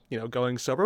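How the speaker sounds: normal talking voice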